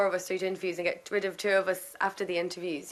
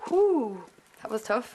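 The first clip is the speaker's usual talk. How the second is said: relieved whew; 4 semitones higher, much slower than usual. Retold or imitated. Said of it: "I'd be like".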